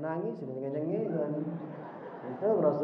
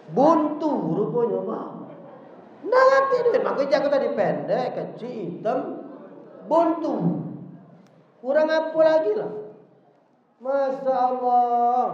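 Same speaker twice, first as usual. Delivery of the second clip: loud, much higher burst of frustration; exasperated outburst; 4 semitones higher, 5 dB louder than usual. Acted. Said bursts out to the Prophet about being broke, loud and high. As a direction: throw up your hands at having nothing. Said laments his poverty, exasperated.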